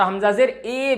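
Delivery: ए as an open, stretched "ay" sound; wrong-example "ay" vowel on ए; majhool vowel. Shown as a demonstration. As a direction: say ए with a long "ay" instead of "i". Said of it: "भी नहीं कहना है".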